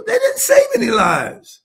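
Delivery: anguished shout. Real, not acted. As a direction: cry out in real anguish. Climax of angry abortion rant.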